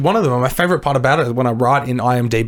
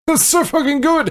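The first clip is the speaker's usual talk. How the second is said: high-pitched, gushing rave; gushing teenage reaction; 11 semitones higher than usual. Retold or imitated. Teen defending a movie everyone else panned, gushing in a high voice. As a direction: squeal it like a thrilled fan.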